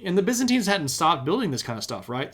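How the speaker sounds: usual speaking voice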